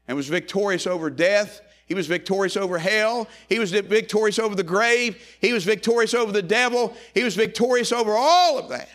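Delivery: repeated lines rising to a crescendo; building preaching refrain. Acted. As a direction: build the refrain toward a climax.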